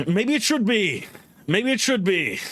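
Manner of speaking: high-pitched mock indignation; exasperated protest.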